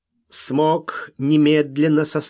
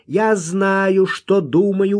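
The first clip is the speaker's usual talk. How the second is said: higher, louder insistence; stubborn retort; 7 semitones higher, 5 dB louder, slower than usual. A narrator's reading. Kid objecting to Wild man's denial, stubbornly. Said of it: "упрямо возразил малыш".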